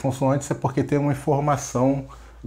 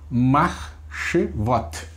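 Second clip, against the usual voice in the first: slow syllable by syllable; much slower than usual. Shown as a demonstration. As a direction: stretch "Marchevot" out slowly, syllable by syllable. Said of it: "Aí você lê de uma vez só".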